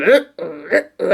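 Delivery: low guttural heaving noises; imitated dog retching. Owner imitating the dog being sick, low retching. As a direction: mimic the dog throwing up, low in the throat. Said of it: "And then I hear him".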